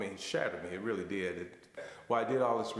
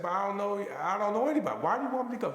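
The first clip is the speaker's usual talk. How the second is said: much higher, louder, disbelieving; incredulous protest; 11 semitones higher, 5 dB louder, faster than usual. Retold or imitated. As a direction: balk at the idea. Protesting to his wife.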